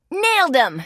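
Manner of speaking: high, triumphant exclamation. Acted. Detective celebrating a hit on his foes, triumphant. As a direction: crow it high, then drop.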